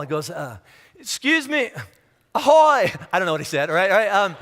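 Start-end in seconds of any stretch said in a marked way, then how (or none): 1.0-2.9 high, loud hail across the water; calling out. Pretend. Jesus hailing the fishermen in their boat, high mock call.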